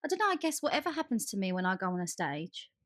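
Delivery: normal talking voice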